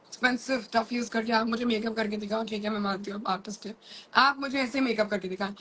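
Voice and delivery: real rapid-fire chatter; words racing along with quick thoughts; talking fast. Real, not acted. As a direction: rattle it off quickly without stopping. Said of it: "इतना fast कैसे बोलती हूँ".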